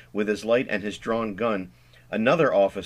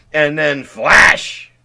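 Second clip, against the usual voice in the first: "flash" stretched very slow and much louder; exaggerated flash; 5 semitones higher, 12 dB louder, much slower than usual. Stanley pitching his party idea, exaggerated drawn-out flash. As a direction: burst out "flash" like a big reveal. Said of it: "He says flash in an exaggerated way".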